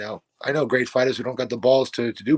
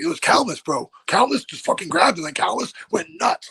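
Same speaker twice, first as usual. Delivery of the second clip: high, hyped high-school gossip; gossiping high schoolers; 8 semitones higher than usual. Retold or imitated. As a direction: retell like an excited teenager. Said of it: "I hear people telling stories about me".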